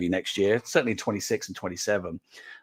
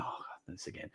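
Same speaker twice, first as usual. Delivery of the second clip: whispered weary "oh God"; eye-rolling groan; 21 dB quieter than usual. Retold or imitated. Team groaning at his mantra, whispered weary mock. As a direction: breathe it out like a bored team. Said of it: "rolling their eyes and go".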